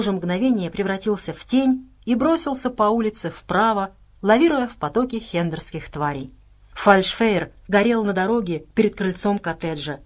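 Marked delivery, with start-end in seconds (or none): none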